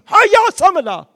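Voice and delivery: sudden high, loud attack cry; shouted battle cry. Acted. Believer whacking the devil who comes to steal, shouted battle cry. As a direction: strike out with the voice.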